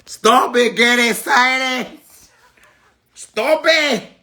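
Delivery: high, drawn-out fake Spanish accent. Repeats phrase in mock accent.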